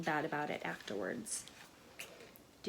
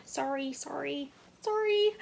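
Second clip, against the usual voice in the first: higher sorries rising to a loud squeak; embarrassed apologies; 8 semitones higher, 4 dB louder than usual. A narrator's reading. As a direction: apologize more and more frantically. Embarrassed school apologizes repeatedly.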